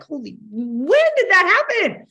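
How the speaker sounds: hammed-up shock at time flying; mock disbelief. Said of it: "Anybody else like".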